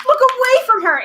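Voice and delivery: high, loud warning shout. A narrator's reading. Girl warning friends about the monster, urgent shout. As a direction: shout it to stop them in time. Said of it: "Annabeth shouted".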